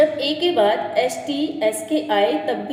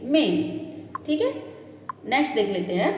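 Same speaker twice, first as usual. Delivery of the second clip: ordinary talk, nothing marked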